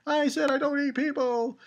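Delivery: high, put-on whiny insistence; mock protest. Giant insisting to the scared kids, high mock protest. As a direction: repeat it in a high character voice.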